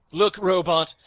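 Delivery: ordinary talk, nothing marked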